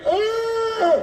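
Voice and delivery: long, high held wail; imitated child's wail. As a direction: hold one high, droning cry. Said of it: "he's going".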